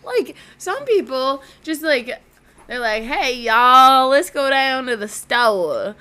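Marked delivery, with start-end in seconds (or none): 3.0-6.0 loud, drawn-out Southern twang; hammed-up Southern "y'all" greeting; Southern accent. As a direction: put on a big slow Southern accent. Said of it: "I feel like accents are nuts".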